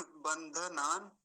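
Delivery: बंधनान slow, ban-dha-naan; full dha. Shown as a demonstration. Teacher repeating the correct word, full dha. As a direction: sound the whole dha in बंधनान. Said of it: "बस ये ध्यान रखेंगे।".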